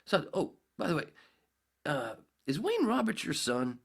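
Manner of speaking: lightly voiced sales manager asking; casual boss question. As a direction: drop it in casually on the way out. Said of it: "he says".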